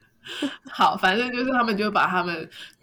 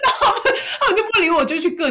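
rushed words through laughter, higher and louder; laughing; 11 semitones higher, 6 dB louder, much faster than usual. Real, not acted. Telling a friend the colleagues ignored her order, laughing fast.

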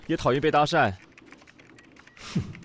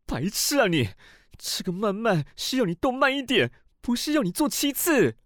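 climbing higher as the scolding builds; exasperated scolding; 5 semitones higher than usual. Acted. Senior corrects his partner's misunderstanding, exasperated scolding. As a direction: scold him, pitch rising to indignation.

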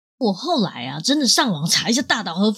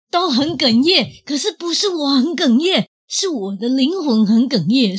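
choked up; 6 semitones higher, 4 dB louder than usual. Real, not acted.

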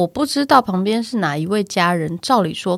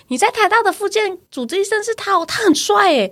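gushing therapist; 11 semitones higher than usual. Retold or imitated. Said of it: "别的医院的复健老师都说".

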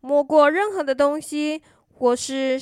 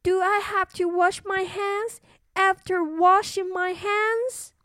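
put-on high worried little girl; cartoon princess; 8 semitones higher than usual. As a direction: fret like a small princess. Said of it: "said the little princess".

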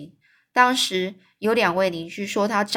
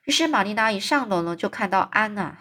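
ordinary delivery

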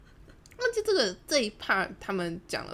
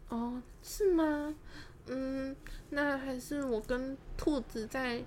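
slow, higher, hesitant doubt; feigned innocence; 6 semitones higher, 5 dB quieter, much slower than usual. Retold or imitated. Offering to talk with the friend, feigned innocence. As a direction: act unsure, drawing the words out softly.

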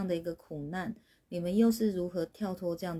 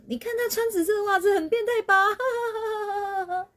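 much higher, louder gossiping jab with a laugh; spreading the joke to everyone, cackling; gleeful teasing; 13 semitones higher, 5 dB louder than usual. Retold or imitated. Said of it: "逢人便讲".

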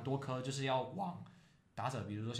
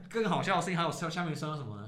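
ordinary talk, nothing marked

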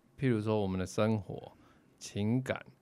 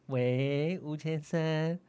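hostess phone call; 2 semitones higher than usual. Pretend. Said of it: "剥皮酒店的那种call center".

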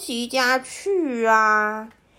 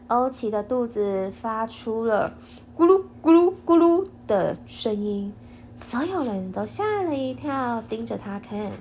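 ordinary delivery, nothing marked

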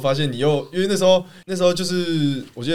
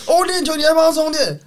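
much higher, louder incredulous jab; mock disbelief; 14 semitones higher, 4 dB louder than usual. Teammate mocking a player for charging a phone, incredulous. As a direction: tease with fake astonishment. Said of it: "然后甚至说".

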